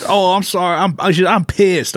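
put-on English accent. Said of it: "she was English".

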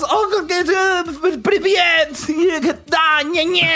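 high, nasal-free babble of fake Russian; mock Russian gibberish. Mocking the Russian crowd in fake Russian babble. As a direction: imitate bystanders in made-up Russian. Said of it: "Y todos".

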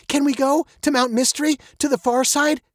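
put-on fast, high-pitched girlish plea; eager young girl. A narrator's reading. Veronica asks Daddy for a trip, eagerly. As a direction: rush the request like a child. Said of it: "she said".

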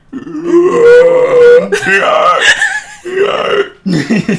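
loud, high shrieking laugh; zombie laugh. Real, not acted. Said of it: "You sound like a zombie".

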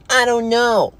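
put-on high, childish voice; whiny child. Child asked who mommy is, high and childish. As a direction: answer like a clueless kid.

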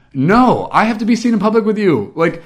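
loud, high-pitched imitation of a whining sister; embarrassed sibling complaining at full pitch; mock whining. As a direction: protest in a hammed-up whine. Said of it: "she just whined the whole way".

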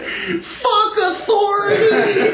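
high, drawn-out mock stage scream; imitated rebel yell. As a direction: imitate a rocker's defiant cry, stretching it out. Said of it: "jumped up on the stage and went".

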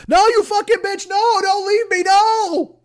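high-pitched desperate screaming; Catherine Martin. Acted. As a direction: scream in panic as the trapped captive begging not to be left. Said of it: "I'm Catherine Martin in The Well".

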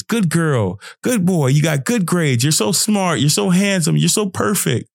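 put-on high, sing-song praise for a child; lightly mimicking adults heaping on praise; praising grown-up. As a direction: gush over the kid like a proud parent. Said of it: "I was always getting praise".

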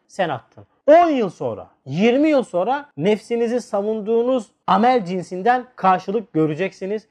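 usual speaking voice

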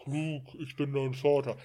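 imitated movie villain with breathy lead-in; film quote impression. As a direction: mimic the famous film line. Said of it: "man macht das ja immer".

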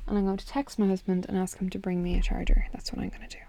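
hushed aside to herself; whispering. Real, not acted. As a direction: whisper it under your breath. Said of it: "whispered plan".

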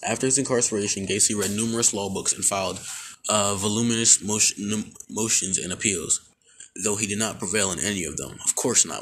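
usual speaking voice

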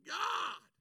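a whining why-me prayer; pleading complaint. Retold or imitated. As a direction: reproach heaven from the roadside. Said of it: "I'm sitting here going".

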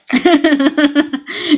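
loud, high burst of laughter; amused laugh. Real, not acted. Friend amused at talk of who cuts cheese, bursting into laughter.

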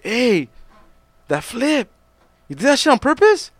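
high-pitched, questioning fan voice; imitated amazed fans. Fans question singer after the show, imitated amazement. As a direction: ask it high, in disbelief.

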